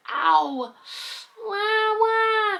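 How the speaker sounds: high mock wah crying; mock baby wail. Toddler upset after biting, high mock wailing.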